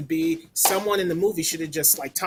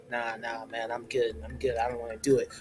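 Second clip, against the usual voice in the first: softer, lazy mock refusal; reluctant sidekick; 5 semitones lower, 5 dB quieter than usual. Reviewer voicing the sidekick turning down the hero, soft mumbled refusal. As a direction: brush it off like a bored sidekick. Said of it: "he's like".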